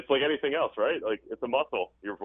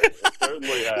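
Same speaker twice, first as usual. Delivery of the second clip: laughing through the words, high and loud; amused answer to the host's joke about a glamorous career, laughing; 5 dB louder than usual. Real, not acted. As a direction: let the laugh break into the repeat.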